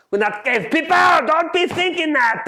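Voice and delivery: very high, loud indignant outburst; passion boiling over. Acted. Chef lecturing on why we need knives, passion boiling over. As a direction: suddenly shriek it in overexcited protest. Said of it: "My enthusiasm and passion, sometimes it boils over".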